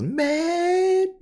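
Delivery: high, slow stretched words; drawn-out emphasis. Real, not acted. Telling the audience how mad mom was, drawn out high. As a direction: stretch it out for effect. Stressing mom's anger.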